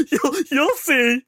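high, slow words through mock laughter; drawn-out squeal, as if helpless with laughter; hysterical laughing. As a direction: imitate someone barely able to speak for laughing. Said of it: "everyone's hysterically laughing".

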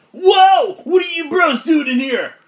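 high, loud dude-bro creature; Fugamon. Acted. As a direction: shout it like a startled bro. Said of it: "Fugamon turns to you and he goes".

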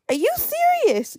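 very high, slow stunned question; squeaky shock. Retold or imitated. Told mum got a dog, very high squeaky shock. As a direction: let it squeak right up to the top in shock. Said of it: "I talked such high pitched there".

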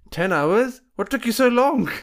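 high, loud mock indignation; a relative scolding lateness, hammed up; mock outrage. Uncle scolding the late arrivals, mock indignant. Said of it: "Asians love doing this".

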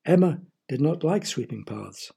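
ordinary delivery

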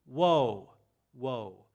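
booming woe, fading away on the last one; God pronouncing judgment, loud woes that fade; God's woe cry. Acted. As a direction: call out the woes like thunder, then let them die. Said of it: "he says".

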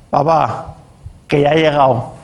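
put-on slurred drunk son mumbling to dad; mock drunk voice at dawn; drunk teen. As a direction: play it sloppy and tipsy, lightly done. Drunk teen speaking to his father.

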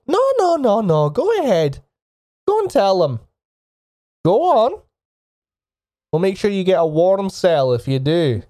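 very high, mock-encouraging officer; goading sergeant. Sergeant pressing the silent orc to confess, high-pitched goading. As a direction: egg the prisoner on, pitched right up. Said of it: "The orc just looks down".

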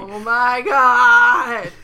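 loud, slow exclamation; loud slow amazement; loud drawn-out oh my god. Real, not acted. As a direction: stretch it out in disbelief. Reacting to the scene.

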